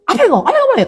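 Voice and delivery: high-pitched snappy telling-off; mock scolding. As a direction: imitate a mother snapping at her child. Mimics young mother scolding child.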